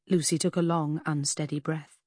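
plain reading voice

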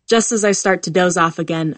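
ordinary delivery

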